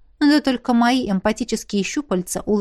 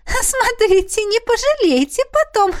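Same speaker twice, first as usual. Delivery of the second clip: high teasing threat; 13 semitones higher, 4 dB louder than usual. Acted. Princess warns, then laughs.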